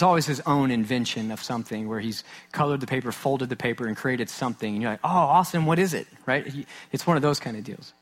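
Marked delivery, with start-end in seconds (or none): 5.0-6.1 higher, louder, bright parental delight; dad playing up his amazement at his son's craft; mock excited parent. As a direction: gush over a kid's drawing. Parent praising child's craft.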